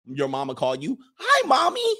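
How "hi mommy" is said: put-on high, clingy little-kid voice; mock mommy's boy. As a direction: pine for mommy in a silly high voice. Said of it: "Do you call her mommy".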